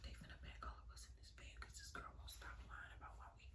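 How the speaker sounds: fast, very soft whispering; hushed mock panic; panicked whisper. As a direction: whisper it in a rush under your breath. Whispering panicked aside to herself.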